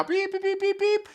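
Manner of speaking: high, even run of mimicked beeps; imitated beeps. Caller cut off mid-threat in the retold call, imitated beeping. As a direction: beep like a disconnected line, high-pitched.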